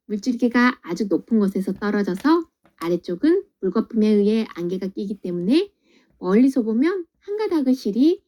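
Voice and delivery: ordinary delivery, nothing marked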